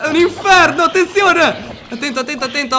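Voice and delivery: high, loud alarmed calls; urgent warning. Real, not acted. Warning friends about the monsters outside, urgent high calls. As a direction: call out the danger urgently.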